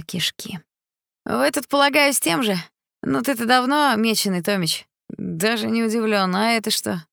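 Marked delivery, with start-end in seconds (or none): 1.2-7.0 grating voice. A narrator's reading. Stepan's voice continues.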